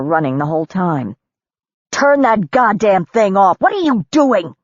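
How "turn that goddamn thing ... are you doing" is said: slow, louder angry demand, rising high; enraged. A narrator's reading. Woman raging at a man filming her, loud. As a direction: bark it at the camera in a rage. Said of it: "filmed her rage".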